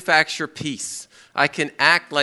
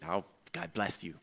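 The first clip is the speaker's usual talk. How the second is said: much softer, low, sugary put-on blessing; fake pious blessing; 9 semitones lower, 15 dB quieter than usual. Preacher mocking a polite blessing to someone who gave the finger, much softer and fake. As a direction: say it sweetly while holding back the anger.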